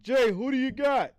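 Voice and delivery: loud high mock of a pestering fan; hammed-up mimic of a chat viewer. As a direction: throw the nagging question back in their face. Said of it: "every time I'm asked".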